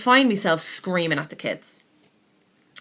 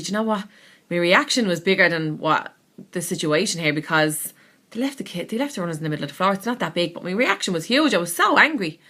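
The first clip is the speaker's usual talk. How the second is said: usual speaking voice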